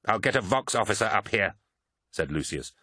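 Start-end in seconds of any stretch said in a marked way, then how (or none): 0.1-1.6 controlled, understated anger; a touch of steel under a firm resolve; steely anger. A narrator's reading. Swordsman accepts the betrayal and takes action, mildly steely anger. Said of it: "a steel anger in his voice".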